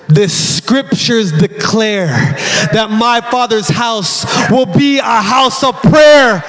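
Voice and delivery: slow scripture declaration rising to a cry; solemn, drawn-out preacher's proclamation; building proclamation. Acted. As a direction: stretch each phrase, building in force. Proclaims Jesus' words, building.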